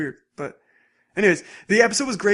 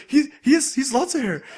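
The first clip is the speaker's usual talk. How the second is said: high, louder, laughing through it; flustered mock protest; 8 semitones higher, 4 dB louder than usual. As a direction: blurt it out while cracking up. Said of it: "Usa's like".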